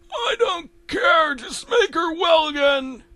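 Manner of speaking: very high, desperate shout; Eustace demanding. Acted. Eustace ordering the doctor to cure his wife, desperate. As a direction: demand it in a high-pitched yell.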